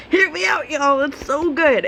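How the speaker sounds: high gushing plea; excited plea. Real, not acted. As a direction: gush about the story. Pleading with viewers.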